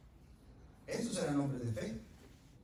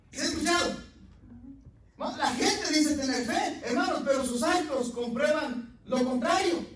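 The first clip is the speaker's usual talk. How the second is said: loud, high preacher's cry to the congregation; full-force preaching; 11 semitones higher, 8 dB louder than usual. Acted. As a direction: thunder it at the congregation.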